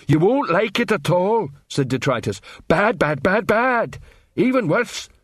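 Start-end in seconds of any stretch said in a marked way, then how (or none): 0.1-1.5 higher, dim troll warning. A narrator's reading. Troll Detritus warns. 2.7-5.1 bad, bad rising higher and higher; building dramatic. A narrator's reading. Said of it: "warming to the part".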